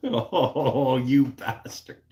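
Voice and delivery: loud laughing "you bastard", drawn out slowly; laughing mock outrage. Real, not acted. Busted by a joke in chat, laughing mock outrage. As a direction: caught out, laugh through the insult.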